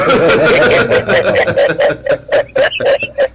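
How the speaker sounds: sustained, hearty laughing; drawn-out amused laugh; long laugh. Real, not acted. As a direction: laugh on and on at the caller. Host laughs after saluting caller.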